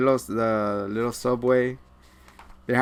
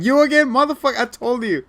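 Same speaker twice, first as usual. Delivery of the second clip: high, loud fed-up yell; exasperated doctor; 10 semitones higher, 7 dB louder than usual. Retold or imitated. Doctor yells at returning Hawkeye, high fed-up outburst. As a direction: snap at the patient who keeps coming back.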